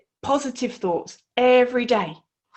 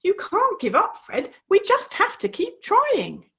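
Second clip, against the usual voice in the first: higher, pleading Flippy; urging frog Flippy; 8 semitones higher than usual. Acted. Flippy begging Fred to keep swimming, higher and urgent. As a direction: plead with your friend not to quit. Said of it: "tried to tell Fred to keep trying".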